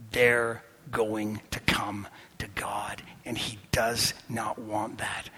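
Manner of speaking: unvoiced hushed whisper. Preacher confiding Jonah's secret to the church, whispered. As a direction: let them in on a secret.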